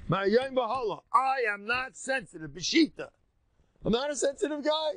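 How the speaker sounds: high, bold and proud; defiant declaration. Shown as a demonstration. Rabbi showing the student how to declare it, defiant and high. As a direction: announce it like a hero who won't bend.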